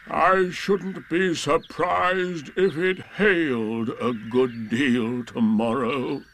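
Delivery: very slow, drawn-out doleful voice; gloomy Eeyore. Acted. Eeyore forecasting bad weather, slow and gloomy. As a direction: drag it out like a glum donkey.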